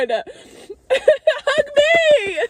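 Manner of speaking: very high, laughing whine; hammed-up begging squeal with laughter; mock pleading wail. Believer begging the Lord for a hug, mock wailing. Imitates own envious plea to God.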